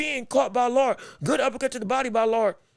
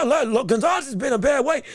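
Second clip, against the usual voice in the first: ordinary talk, nothing marked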